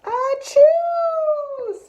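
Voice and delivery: huge high-pitched "achoo"; big acted sneeze. Bear wakes with pepper in his nose, big sneeze. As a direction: let out a loud, drawn-out storybook sneeze. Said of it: "says the bear. A big, big, big sneeze".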